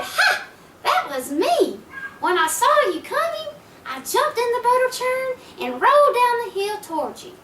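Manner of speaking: high, triumphant little pig crowing; gloating little pig. Acted.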